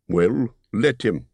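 ゆっくり突き放すように言うバルド; 少しだけ声色を変えた弓の名手; lightly voiced Bard.

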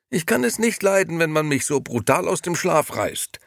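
high, whining grumble; whiny complaint. A narrator's reading. Gigi complains to Beppo about being woken, high whining. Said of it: "jammerte er erschrocken".

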